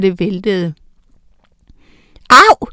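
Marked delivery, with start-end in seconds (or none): 2.3-2.7 sudden high yelp of pain; loud, high "Au!" of pain; howl of pain. A narrator's reading. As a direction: yelp as if hit on the head. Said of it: "hylede Harald".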